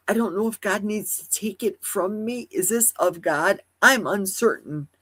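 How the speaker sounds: usual speaking voice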